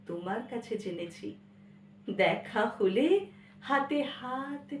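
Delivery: dramatic recital between loud surges and hushed drops; poetry recitation. Acted. Child recounting lessons learnt from mother, heartfelt recitation.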